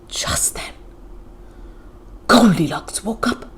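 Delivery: hushed, breathy whisper; suspense whisper. A narrator's reading. Narrator whispering the moment Goldilocks wakes.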